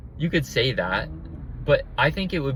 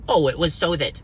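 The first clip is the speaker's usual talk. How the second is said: mocking quote. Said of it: "People say".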